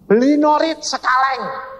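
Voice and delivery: high-pitched, emphatic command; God. Retold or imitated. God answering the wife's prayer, commanding. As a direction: pronounce it as a ringing order. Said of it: "Tuhan ngomong gini".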